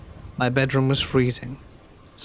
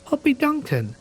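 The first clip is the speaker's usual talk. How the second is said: put-on high-pitched little child voice; toddler's voice. A narrator's reading. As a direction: voice a toddler naming someone, pitched way up. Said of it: "She would say".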